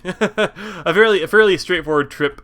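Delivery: giggling through the words after a slip; laughing. Real, not acted. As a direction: laugh it out, embarrassed. Restarts phrase laughing after slip.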